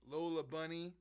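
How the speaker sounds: ordinary delivery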